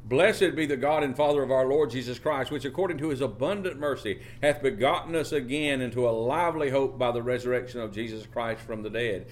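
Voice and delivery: preacher reads First Peter aloud; scripture reading. Acted. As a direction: proclaim the verse.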